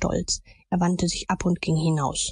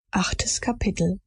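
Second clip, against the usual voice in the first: whispered. A narrator's reading. Chapter heading whispered.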